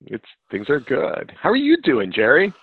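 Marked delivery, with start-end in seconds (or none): none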